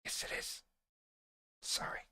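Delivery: whispered aside, barely voiced. Host whispering an aside to himself about his MPD. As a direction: murmur it under your breath like a second self.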